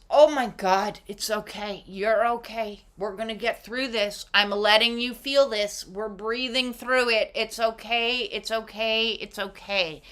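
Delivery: soft, hushing reassurance; calm someone through panic, gently; soothing comfort. Pretend. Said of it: "Strong is".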